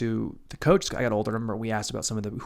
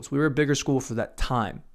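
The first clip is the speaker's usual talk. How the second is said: normal talking voice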